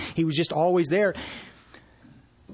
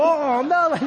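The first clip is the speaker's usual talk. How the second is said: high, louder hammed-up groan of dismay; a playful oh no, feigning alarm; mock dismay; 10 semitones higher, 4 dB louder than usual. Friend hangs up again.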